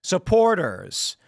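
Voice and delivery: "Supporters" drawn out slowly, falling to a close; slow, final fall on "Supporters"; downward inflection. Shown as a demonstration. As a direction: stretch "Supporters" and let it drop like a full stop. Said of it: "Downward inflection, implying the idea was complete".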